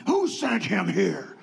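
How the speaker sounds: slow, gruff question repeated; mock the curt doctor; rude doctor. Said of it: "rude doctor repeated".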